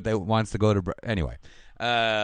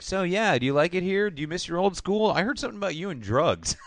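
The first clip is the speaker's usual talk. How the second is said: high, breezy mock small talk; lightly mock a smarmy flirt; mock Brandon; 7 semitones higher than usual. Retelling the episode, mocking Brandon chatting up Carla. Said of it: "He's like".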